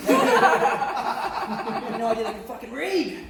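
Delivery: cracking up at his own joke; drawn out and giggling; laughing punchline. Real, not acted.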